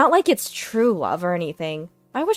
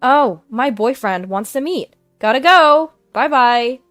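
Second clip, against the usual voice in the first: higher, louder cheerful exit; breezy goodbye; 4 semitones higher, 7 dB louder than usual. Acted. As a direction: chirp it carelessly. Cheerfully leaving.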